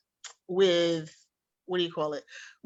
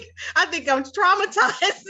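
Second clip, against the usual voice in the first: high, laughing mock distress; 9 semitones higher, 3 dB louder than usual. Real, not acted. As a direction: say it through laughter, pitched up. Laughing over teased accent.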